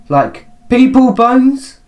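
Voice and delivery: high, loud, drawn-out question; hammed-up shock. Player voicing a character who finds bones, hammed-up shock. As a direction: overplay the horrified disbelief, voice shooting up. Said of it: "amazing voice acting by me right now".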